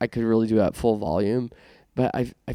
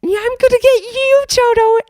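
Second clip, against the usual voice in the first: shrieking, much higher and louder witch cackle-voice; Wicked Witch impression; 12 dB louder than usual. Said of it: "I prefer the Wicked Witch lines".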